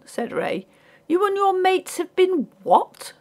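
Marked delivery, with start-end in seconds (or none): 1.1-3.1 high-pitched astonished question; incredulous. A narrator's reading. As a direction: climb high in disbelief. Ray is incredulous at porter.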